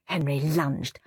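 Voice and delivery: ordinary delivery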